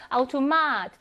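아우토마트 with stress on long 마; end-stressed demo of 아우토마트; last-vowel stress. Shown as a demonstration. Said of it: "또 마지막 모음의 강세가 있고요".

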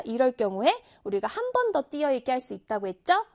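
normal talking voice

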